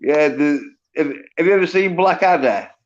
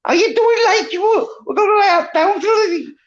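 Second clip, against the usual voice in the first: much higher, whiny mock Liverpool accent with a laugh; hammed-up Liverpool twang, pitched way up. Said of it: "and it all started going".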